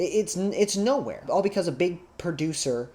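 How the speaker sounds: normal talking voice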